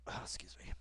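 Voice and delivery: ordinary talk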